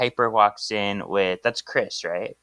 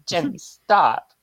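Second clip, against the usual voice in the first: higher, drawn-out mock reprimand; exaggerated scolding; 6 semitones higher, slower than usual. [name] telling her student off, high drawn-out mock scolding. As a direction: stretch it out in hammed-up disapproval.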